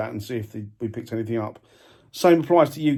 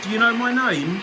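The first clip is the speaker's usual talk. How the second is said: raised, high voice calling out; 8 semitones higher, 4 dB louder than usual. Real, not acted. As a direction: call out to an unseen spirit. Calling out to spirits.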